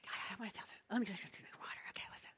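hushed aside, barely voiced; whisper. Real, not acted. Whispering an aside to the congregation before the story. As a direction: lean in and whisper the confession.

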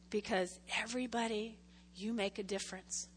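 soft, hushed closing encouragement; tender reassurance. Real, not acted. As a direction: speak it gently to each person. Closing blessing to congregation.

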